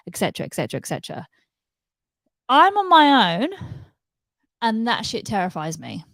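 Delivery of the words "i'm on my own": loud, high, drawn-out emphasis; emphatic. Real, not acted. Telling viewers she lives alone, emphatic. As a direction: stretch "own" out, pitched up and loud.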